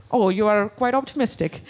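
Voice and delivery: normal talking voice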